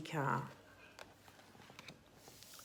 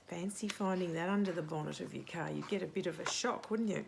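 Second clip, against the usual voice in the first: usual speaking voice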